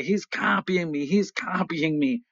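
imitating kids griping about a copycat; childish complaint. Brothers bickering as kids, mock complaint. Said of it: "And we would say".

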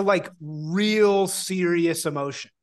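ordinary talk

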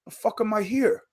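ordinary talk, nothing marked

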